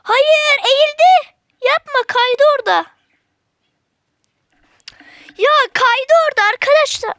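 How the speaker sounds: high, loud, fast burst of alarm; excited outcry. Real, not acted. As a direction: blurt it out as the enemy slips away. Oyunda heyecanlı anlatım. (yüksek sesle heyecanlı haykırış)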